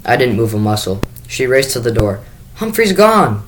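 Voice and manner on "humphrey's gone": a high, loud wail. Acted. Sophie tells her parents the hamster is gone, a high loud wail. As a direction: let the news break out as a wail, much higher. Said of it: "she wailed".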